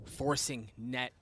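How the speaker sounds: hushed, tense play-by-play; esports caster. Real, not acted. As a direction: narrate the standoff quietly. Casting a tense one v one.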